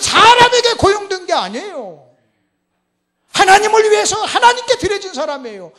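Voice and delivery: high, loud, impassioned sermon cry; thundering pulpit delivery; full-force preaching. Real, not acted. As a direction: drive the point home to the congregation at full volume.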